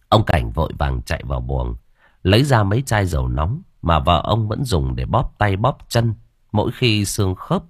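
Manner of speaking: usual speaking voice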